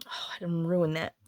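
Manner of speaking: ordinary talk, nothing marked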